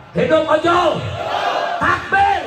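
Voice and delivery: high, loud rally cry; shouted call-and-response slogan. Real, not acted. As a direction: lead the crowd's chant at full voice. Leading crowd in rally slogan.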